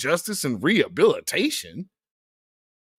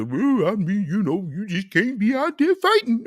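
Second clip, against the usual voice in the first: lightly voiced preachy relative, a bit higher; mock family relative; 5 semitones higher than usual. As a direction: gently mimic a relative scolding the kid. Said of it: "when you got folks in your family".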